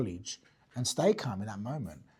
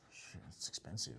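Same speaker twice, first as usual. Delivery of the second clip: under-the-breath whispered grumble; muttered dismay; 8 semitones lower, 17 dB quieter than usual. Retold or imitated. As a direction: mutter it like a shocked client. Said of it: "I've seen their face go".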